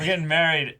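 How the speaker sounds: high, loud giddy gush; excited parents announcing the wedding, gushing; mock excited.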